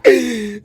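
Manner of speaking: a wailing burst of laughter; laughing shout. Real, not acted. Fan cracking up at his own lament, loud laughing wail.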